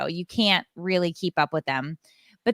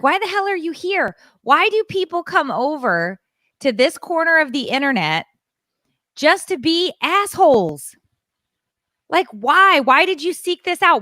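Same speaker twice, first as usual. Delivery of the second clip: much higher, louder furious tirade; retold rant; 8 semitones higher, 4 dB louder than usual. [name] rants at the troll on her live, higher and louder. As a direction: go off on the troll, heated. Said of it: "I just start going off".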